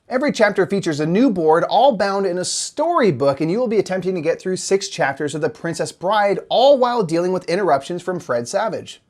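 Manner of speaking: bright newscaster read; parody news anchor. Acted.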